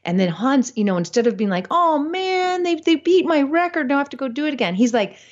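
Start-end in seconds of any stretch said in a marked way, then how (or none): 1.7-4.7 higher, whiny mock disappointment over a lost record; mock dismay. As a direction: play a sulky loser, pitched up. What Hans doesn't say, mocked.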